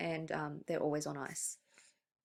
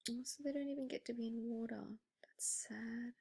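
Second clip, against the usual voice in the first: soft, fast whisper of pity; sad whisper; 4 semitones higher, 10 dB quieter than usual. Real, not acted. Teacher whispering her pity for lobsters kept out of water. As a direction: breathe it out, sorry for the lobsters.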